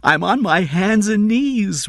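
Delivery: high, loud, drawn-out plea; desperate begging. A narrator's reading. Father on his knees to employer, desperate begging. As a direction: beg slowly at full desperate pitch.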